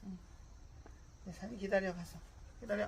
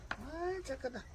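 sweet dog praise; 7 semitones higher than usual. Real, not acted.

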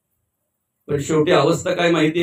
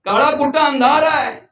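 anguished lament; 8 semitones higher than usual. Acted. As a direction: wail your torment in a high acted voice. Tormented man laments his suffering. (वेदनेने विव्हळत, उंच आवाजात)

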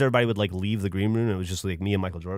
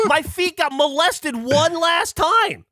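high, loud indignant shouting; comic outrage; 4 dB louder, slower than usual. Real, not acted. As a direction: shout it as an outraged punchline, stretched out. Mock outrage at story's climax.

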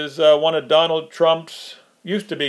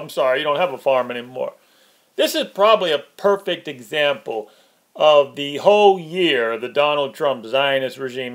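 ordinary talk

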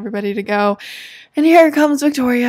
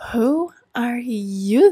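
ordinary delivery

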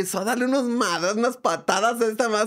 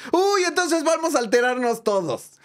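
cheeky retort; 9 semitones higher than usual. Retold or imitated. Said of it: "yo tuve a bien decir".

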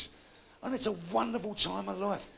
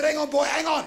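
loud, raised-pitch call to hold on; exasperated dad; 7 semitones higher, 6 dB louder than usual. Acted. Dad halting his pestering son, exasperated. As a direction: cut the boy off loudly.

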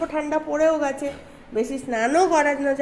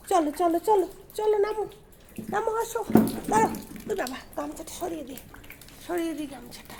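much softer, higher gentle prompts; soft coaxing; 4 semitones higher, 8 dB quieter, faster than usual. Real, not acted. Mother drying her pet with a towel, soft coaxing. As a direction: hush and coax gently.

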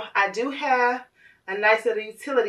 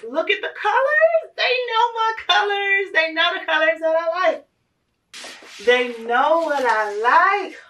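overjoyed; 7 semitones higher than usual. Real, not acted. Overwhelmed by gift colors.

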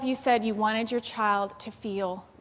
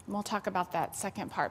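usual speaking voice

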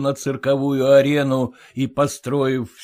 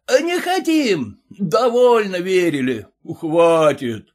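crowd shout; 6 semitones higher, slower than usual. A narrator's reading.

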